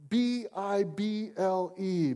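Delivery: B I B L E spelled out letter by letter, slow; spelled out slowly. Shown as a demonstration. Preacher spelling out the Bible acronym to the church, slowly. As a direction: spell out B I B L E, each letter apart. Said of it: "I told you".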